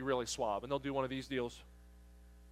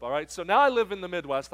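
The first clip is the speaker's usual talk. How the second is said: normal talking voice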